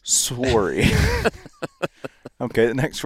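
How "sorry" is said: "Sorry" with a drawn-out, rounded O; exaggerated Canadian O. Shown as a demonstration. American told to pronounce the O, "Sorry" with exaggerated O. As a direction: overdo the O in "Sorry", like "soooree". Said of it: "You gotta pronounce the O".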